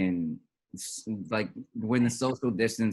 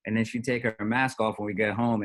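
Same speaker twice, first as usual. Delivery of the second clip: ordinary talk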